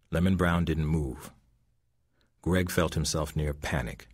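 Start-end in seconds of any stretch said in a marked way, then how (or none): none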